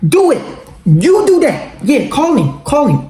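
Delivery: high, mocking dare; high-pitched taunting dare; daring. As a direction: egg her on to call him. Daring her to call him.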